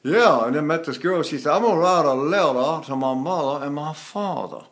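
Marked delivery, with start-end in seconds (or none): 1.5-4.7 put-on Mississippi accent. Voice teacher imitating a girl from Tupelo, Mississippi accent. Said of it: "In Tupelo, Mississippi".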